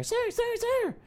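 very high, squeaky mimicked call; mimicked calling after. Waitress chasing a customer leaving the pub, high mimicked calling. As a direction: call after someone in a tiny high mock voice.

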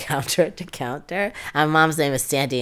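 normal talking voice

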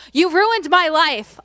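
loud, slow, bitter "you ruined my life"; hammed-up wounded accusation; angry accusation. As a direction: throw the blame at the brothers, hard and slow. Joseph voiced, mock reproach.

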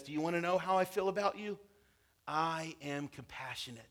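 whisper-close comfort, gently; soft, tender. Acted. God telling his people how he feels, softly and tenderly.

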